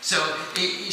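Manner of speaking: ordinary delivery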